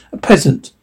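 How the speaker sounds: ordinary delivery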